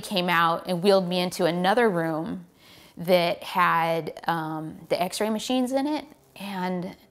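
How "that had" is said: ordinary talk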